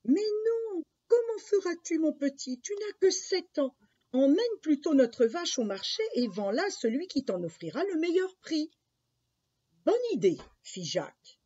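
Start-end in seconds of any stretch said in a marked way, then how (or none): 0.0-8.7 high, louder motherly objection; protesting mother. Acted. Mother tells seven-year-old Jacques to sell the cow, protesting. Mother overrules her young son. 9.8-10.4 high, loud burst of agreement; eager boy. Acted. Jacques jumps at his mother's plan, high and eager.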